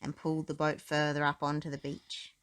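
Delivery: usual speaking voice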